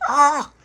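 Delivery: high loud scream. Retold or imitated. Dolores falling off the toilet roof, loud scream. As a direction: shriek as you fall. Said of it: "Like that".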